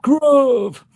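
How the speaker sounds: high, loud, held-out note; sustained high-pitched cry; high drawn-out call. Retold or imitated. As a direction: call the name out high and long. Calls out the show's name.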